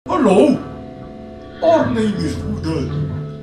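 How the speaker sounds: normal talking voice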